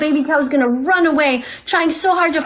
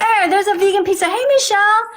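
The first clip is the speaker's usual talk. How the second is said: bright chat message voiced higher; acted viewer comment; 6 semitones higher than usual. Viewer's typed comment to Michelle, voiced cheerfully higher. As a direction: read it like an excited viewer typing. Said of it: "you type".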